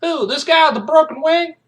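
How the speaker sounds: high, loud call back to a comrade; mock shouted question. Soldier checking the target with his commander, mock shouted question.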